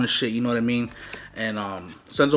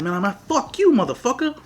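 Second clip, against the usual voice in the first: higher-pitched indignant cursing; retold angry comeback; 9 semitones higher than usual. Storyteller cursing back at the dope seller, re-enacted anger. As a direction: throw the insult back, acting it out.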